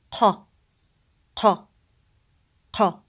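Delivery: "qa" popped from the back of the mouth; deep qaf. Shown as a demonstration. Teacher repeating qaf for students, uvular stop. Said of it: "It's deeper than the English K sound".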